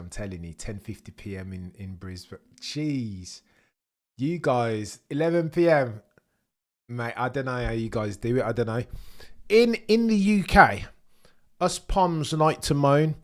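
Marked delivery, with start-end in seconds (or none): 2.6-6.0 amazed. Real, not acted. Amazed at late-night viewers. 9.5-13.2 emphatic. Real, not acted. Mocking British complaining.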